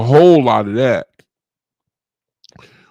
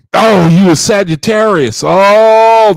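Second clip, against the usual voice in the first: loud, drawn-out oh of discovery; excited recognition; 4 semitones higher, 7 dB louder than usual. Real, not acted. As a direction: exclaim as if it all makes sense. Delighted on learning listener's sign.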